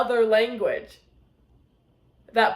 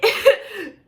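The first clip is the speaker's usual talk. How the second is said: giggle; 9 semitones higher, 4 dB louder than usual. Real, not acted. Giggling at the book.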